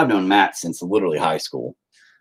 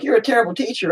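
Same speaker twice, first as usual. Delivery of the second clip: higher scolding parent; mock parent; 13 semitones higher, faster than usual. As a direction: scold him like an angry mom. Imitating parent reply.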